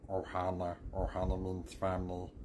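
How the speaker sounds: cartoon alien creature voice; Stitch. Showing friends a learned trick, alien creature voice. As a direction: do a little alien critter impression. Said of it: "I learned that I could do Stitch".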